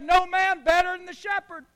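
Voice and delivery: put-on bursting kid's pride; excited little boy. Boy voiced.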